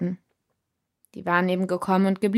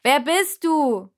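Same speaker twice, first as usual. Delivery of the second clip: slow, much higher, louder shout; 8 semitones higher, 5 dB louder, slower than usual. A narrator's reading. Shouting the question into the giant's ear, loud and slow. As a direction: cup your hand and yell it into his ear. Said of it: "schirmte mit der Hand meine Stimme ab und rief".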